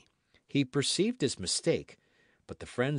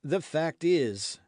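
higher, slow, tentative opening; hesitant, slightly raised voice; timid; 5 semitones higher, 4 dB louder, slower than usual. A narrator's reading. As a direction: venture it timidly. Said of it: "hazarded D'Artagnan timidly".

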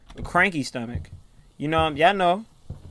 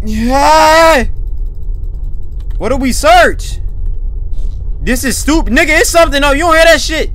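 high, loud frightened shouting; frantic, much higher and louder; panicked yelling; 11 semitones higher, 12 dB louder than usual. Real, not acted. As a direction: yell at the character in a panic. Panicked player yells at game.

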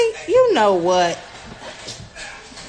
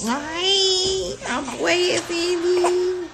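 high, slow, whiny character voice; Cindy voice; 9 semitones higher, much slower than usual. Pretend. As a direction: introduce yourself as a silly character. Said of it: "I look like bald-headed Cindy".

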